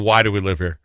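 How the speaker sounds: usual speaking voice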